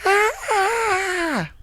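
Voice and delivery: high, drawn-out mock wail; imitated scream-cry. Father imitating his small child left alone, high wailing scream-cry. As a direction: imitate a toddler's long crying scream. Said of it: "just scream crying".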